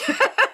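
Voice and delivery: high burst of giggling; giggle. Real, not acted. Cohost giggling at Sandra spelled backwards. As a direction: giggle at the silly name.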